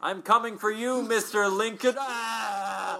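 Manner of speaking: loud high defiant dying shout; death cry. Shown as a demonstration. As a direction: yell your last threat then scream.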